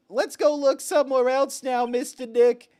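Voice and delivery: put-on high, eager child voice; little girl voice. Pearl quote voiced.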